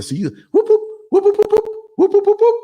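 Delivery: high imitated car alarm whoops; imitating a car horn beeping in a lot; car alarm chirp.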